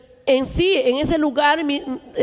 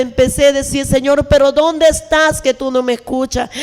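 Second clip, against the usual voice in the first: loud, forceful preaching voice; impassioned testimony; 6 dB louder than usual. Real, not acted. Woman testifying to church about her pain, loud impassioned preaching. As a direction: pour out the suffering at full volume.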